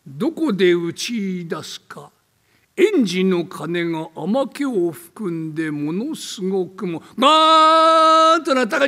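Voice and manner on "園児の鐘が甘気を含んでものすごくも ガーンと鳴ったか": spooky narration with tolling bell. Acted. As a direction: let the bell boom out. Ghost story bell tolls.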